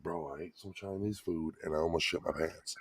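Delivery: low, hushed imitation of a whisper; retold whisper. Father owning up to his son in the theater, hushed. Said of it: "go over and whisper to him".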